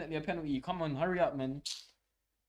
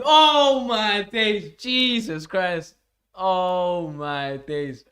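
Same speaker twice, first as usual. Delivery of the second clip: slow, drawn-out loud disbelief, fading down; real amazed slow exclamations; stunned disbelief; 3 semitones higher, 13 dB louder, much slower than usual. Real, not acted. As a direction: stretch every word in shock. Disbelief after dramatic save.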